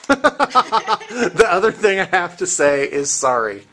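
talking through loud laughter; laughing. Real, not acted. Teasing a coworker over an apology, laughing.

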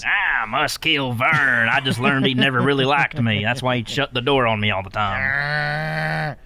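hammed-up dim brute with a grudge; Ernest. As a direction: stretch the words in mock rage. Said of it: "He'd be so angry about it".

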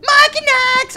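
very high, loud delighted shout; excited squeal. Real, not acted. Host discovering favourite candy, high loud squeal. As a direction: shriek it with joy.

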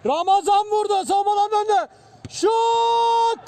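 high, loud goal scream; screaming football commentator. Real, not acted.

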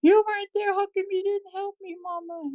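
high-pitched reproach to mama; high, plaintive childlike protest; imitated mad child. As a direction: act out a hurt little boy's accusation. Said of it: "he's just mad at me".

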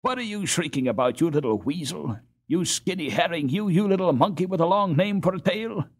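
high, loud mocking insults; furious taunt. A narrator's reading. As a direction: sneer at the little boy, rising in fury. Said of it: "He wheeled furiously upon Woest".

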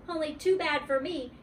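ordinary delivery

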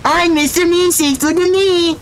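very high cartoon squeal; Mister Meeseeks. Shown as a demonstration. Streamer shows off his impression, high cartoon voice. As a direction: pitch it way up into an eager character impression. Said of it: "voice impression".

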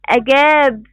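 "أجاب" with the long ا held out; teacher's stretched alif in "أجاب"; long alif madd. Shown as a demonstration. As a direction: draw out the ā in "أجاب" for the class. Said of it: "بها مد بالألف".

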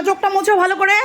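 normal talking voice

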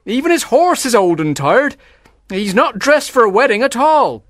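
eager, animated servant's report; excited announcing. A narrator's reading. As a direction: pile up the odd details excitedly. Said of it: "an excited shout".

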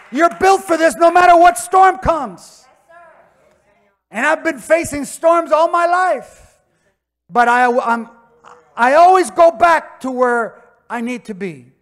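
loud, high-pitched pulpit preaching; preaching at full force. Acted. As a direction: preach it out to the congregation. Preaching climax.

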